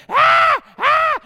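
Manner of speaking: put-on high, loud hungry-baby wail; mock newborn screaming for milk; imitated baby wail. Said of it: "they'd be like".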